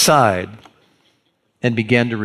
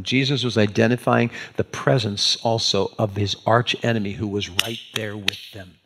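ordinary talk, nothing marked

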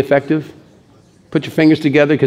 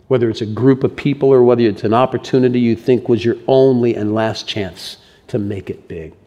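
ordinary delivery

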